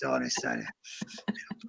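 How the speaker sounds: low, unvoiced creepy aside; whisper. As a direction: breathe it out as a sneering aside. Puppet drops to a whisper.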